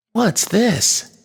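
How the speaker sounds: put-on high little-girl voice, doubtful; disapproving child. A narrator's reading. Bella eyeing her sister's breakfast plate, high and disapproving. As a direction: pitch it high, a child questioning her food. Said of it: "looking disapprovingly at the plate".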